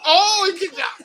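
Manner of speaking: excited laughing shout. Real, not acted. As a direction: yell it out through a laugh. Kick-out in video game match.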